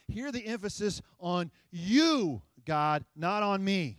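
"you" held long and slow; stress on YOU. Shown as a demonstration. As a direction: lean on "you", not "me". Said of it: "I want you to hear the emphasis".

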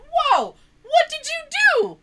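very high, loud, drawn-out mock shock; alarmed disbelief. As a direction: stretch out an appalled, high-pitched question. Retold shock at sister's news.

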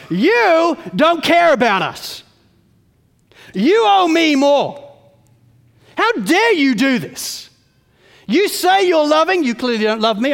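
indignant mock of Israel's grumbling; accusing God. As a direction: hurl it at God as an ungrateful rebel. Preacher voices Israel accusing God.

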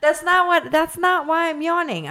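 giggling high-pitched denial; laughing protest. Real, not acted. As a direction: squeal through laughter that you're not bored. Laughing denial while yawning.

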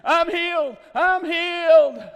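very high, very loud yell; hammed-up ecstatic shout, drawn out; jubilant shouting. Healed man runs around the service, jubilant shouting.

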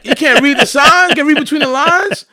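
high, loud, incredulous ribbing; mock outrage. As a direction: heckle in mock disbelief. Ribbing the manager's failure to understand.

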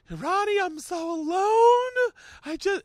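high, loud, drawn-out mock wail; Fez crying. Co-host pouring out loneliness to the host, hammed-up wailing. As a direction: imitate a grown man bawling in falsetto. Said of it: "Fez is crying".

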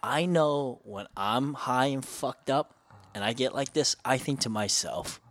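imitating a calm, courteous friend; polite. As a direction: gently reason it out, unhurried and quiet. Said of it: "he said it so politely".

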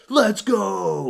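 high, loud "let's go" cheer; gamer victory shout. Retold or imitated. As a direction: celebrate the win like a streamer. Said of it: "like the classic gamer thing".

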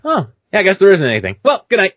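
high, loud mock-casual sign-off; comically carefree, going straight back to bed; blithe dismissal. Blithely dismisses it to frightened wife.

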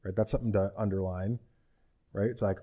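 normal talking voice

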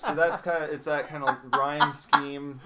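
talking through laughter; laughing. Real, not acted. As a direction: still laughing, loud. Laughing after the dirty limerick.

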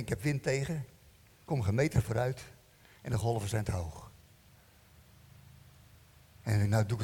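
low, resigned self-talk; discouraged inner monologue. Retold or imitated. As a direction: recount giving up, voice sunk low. Acts out his own discouraged thoughts. (moedeloos in jezelf pratend)

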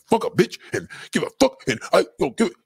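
hint of a guy talking big; mock tough guy. Man mimicking guys he knows, lightly put-on voice. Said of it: "I know guys that talk like that".

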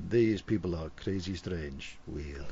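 low, soft bemused voice; lightly voiced confused outsider; puzzled foreign listener. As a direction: mutter it like a baffled stranger. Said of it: "they go".

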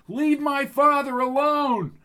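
a daughter trying to protect her father; desperate scream. Acted. Lucy screams at agents.

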